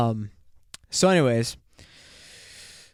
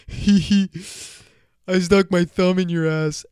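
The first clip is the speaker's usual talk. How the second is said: higher and louder, sly giggly prank voice; giggly Kyle voice; 6 semitones higher, 6 dB louder than usual. Retold or imitated. Kyle confessing the prank, giggly and higher. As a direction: play a sneaky giggling prankster. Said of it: "and then Kyle's like".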